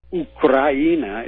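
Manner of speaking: "Ukraina" said the Russian way, oo-kra-EE-na; Russian pronunciation. Shown as a demonstration. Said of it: "is how it's pronounced in Russian".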